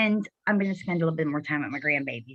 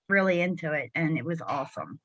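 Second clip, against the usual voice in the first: ordinary talk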